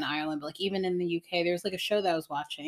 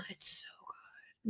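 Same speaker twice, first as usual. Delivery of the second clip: slow, soft whisper; 13 dB quieter, slower than usual. Real, not acted. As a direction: breathe it out as a whisper. Whispered reply in chat.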